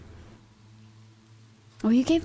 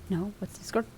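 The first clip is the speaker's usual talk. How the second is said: usual speaking voice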